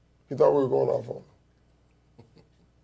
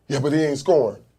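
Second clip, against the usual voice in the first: louder, higher objection; mock retort; 4 semitones higher, 6 dB louder than usual. Retort to the coach's advice about the kid, raised voice. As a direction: fire back an objection, raised voice.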